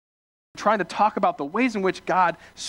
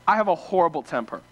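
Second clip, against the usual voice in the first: normal talking voice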